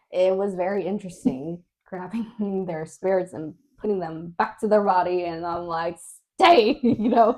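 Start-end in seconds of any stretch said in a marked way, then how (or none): 6.4-6.8 high, loud one-word order; mock exasperated command; commanding mother. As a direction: act out ordering a runaway to stay put. Ordering spirits back into bodies.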